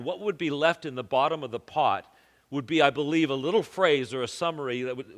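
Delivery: ordinary talk, nothing marked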